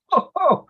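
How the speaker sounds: high, loud burst of laughter; real laugh. Real, not acted. Coach reacts to the story, laughing high and loud.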